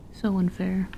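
usual speaking voice

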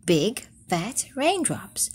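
hushed storybook whisper; whispered narration. A narrator's reading. Reading to children, drawing them in with a whisper. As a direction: whisper it like rain starting.

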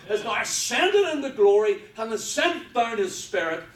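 loud, high-pitched preacher's cry; preaching at full force. Real, not acted. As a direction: proclaim it at full volume to the congregation.

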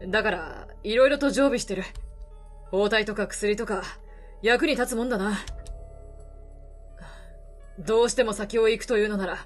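put-on gruff weary older man, low. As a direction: speak low like a tired drifter. Voices the reporter.

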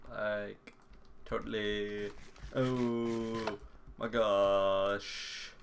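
very slow, held vowels; drawn-out mock amazement. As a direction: stretch every word out absurdly long. Playful drawn-out mock reaction.

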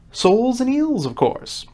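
mildly impatient, starting high and dropping; rather impatient. A narrator's reading. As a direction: answer as if it were obvious. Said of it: "replied rather impatiently".

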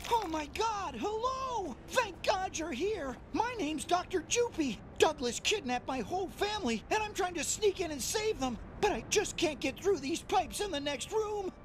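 high, jittery panicked little scientist; fretful imposter doctor pleading for help, fast and breathless; anxious little scientist. Acted. Imposter doctor begs the player for help, jittery and panicked. Jupy character voiced in game.

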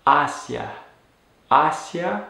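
"hacia" slowly modelled as HA-sya, first syllable stressed. Shown as a demonstration. Teacher repeating the preposition for learners, "hacia" stressed as HA-sya. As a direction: land on the opening syllable each time in "hacia", HA-sya. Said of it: "with the stress on that first syllable".

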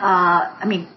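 usual speaking voice

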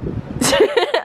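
short high laugh. Real, not acted. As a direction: burst into a laugh. Laughs at the friend's jab.

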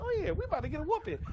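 high, fast panicked squeak; mock dread. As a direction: rattle it off in a high rush. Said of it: "I was like".

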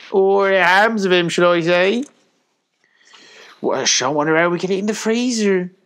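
high, loud farm wife, full of wonder; chattering farmer's wife. A narrator's reading. Farmer's wife marvelling at dog and piglet, high chatter. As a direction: run on loudly in a high put-on voice.

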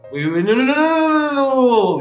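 high, loud excited no no. Acted. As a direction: burst out with giddy delight. Excited at love confession.

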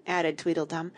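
plain reading voice

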